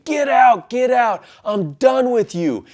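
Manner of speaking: shouting to get out, slowing on the last words; furious yell. Retold or imitated. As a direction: throw him out at the top of your voice. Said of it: "Stephanie's yelling at me".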